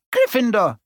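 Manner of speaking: high ringing shout. Acted. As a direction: call it out to the whole hall. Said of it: "the Hat had shouted".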